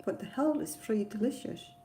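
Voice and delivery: ordinary talk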